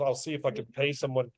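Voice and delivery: ordinary talk, nothing marked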